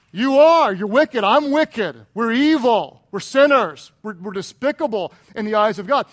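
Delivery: loud, emphatic string of accusations; forceful preaching. Real, not acted. Preacher counting himself and congregation as wicked, forceful. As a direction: hit each short verdict hard.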